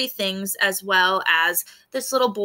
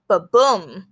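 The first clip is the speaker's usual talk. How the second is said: booming thunder word, louder; thunder sound word; 2 semitones lower, 6 dB louder than usual. A narrator's reading. Teacher voicing the thunder for her class, booming. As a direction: make the thunder boom out.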